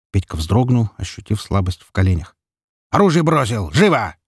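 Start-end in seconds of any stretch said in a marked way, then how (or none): 2.9-4.2 high, forceful snarled command; barked order. A narrator's reading. Zhelezny disarming the boy, barking. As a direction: snap out the order like a bark. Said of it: "рыкнул выродку Железный".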